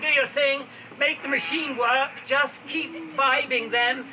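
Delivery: loud, drawn-out orders to a gang; loud rallying command. Pretend. Host sets the gang to work on the machine, rallying loudly.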